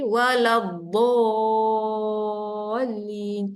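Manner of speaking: "Waladdallin" stretched very slowly with a heavy dod; slow Quran recitation. Shown as a demonstration. Teacher modelling the correct reading of dod. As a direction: recite "Waladdallin" drawn out, tongue side on the molars. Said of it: "Tu cara sebutan huruf dot".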